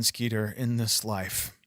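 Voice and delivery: soft, dropped, solemn voice; grave hush. Acted.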